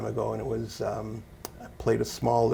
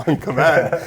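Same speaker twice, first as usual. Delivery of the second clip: laughing; 5 semitones higher, 13 dB louder, slower than usual. Real, not acted. Laughing echo of listener.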